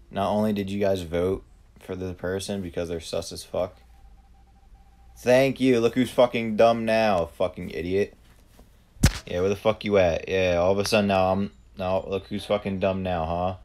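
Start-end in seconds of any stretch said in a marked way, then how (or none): 5.2-13.6 triumphant gloating. Real, not acted. Gloating at the players who doubted him, loud and triumphant. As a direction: rub it in that you were right. Gloating after being proven right.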